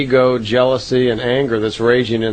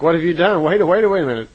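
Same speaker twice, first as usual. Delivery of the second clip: higher, louder retold alarm; mildly excited; 6 semitones higher, 4 dB louder, faster than usual. Startled pilot to Billy after he fires early, mildly excited. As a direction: quote her urgent objection, pitched up and a bit louder. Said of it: "she gets a little excited".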